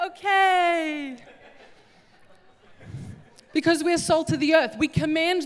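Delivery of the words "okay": put-on flat unimpressed kids. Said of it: "They're like".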